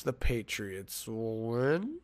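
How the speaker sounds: soft, slow, unsure lift on "win"; questioning. Real, not acted. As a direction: WIN drawn out and sliding up into a question. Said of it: "Question mark?".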